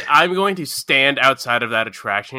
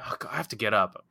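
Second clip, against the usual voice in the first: ordinary talk, nothing marked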